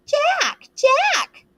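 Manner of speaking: high mocking echo of Ralph's call; bitter mimicry. A narrator's reading. Jack mocking Ralph's call, bitter high mimicry. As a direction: parrot him with scorn. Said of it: "Jack's voice sounded in bitter mimicry".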